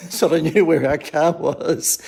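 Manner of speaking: amused at himself, laughing as he explains; laughing while talking. Real, not acted. Laughs at his own car photo.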